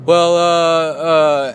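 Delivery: sheepish stalling; caught-out stammer. Retold or imitated. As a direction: play the busted guy fumbling.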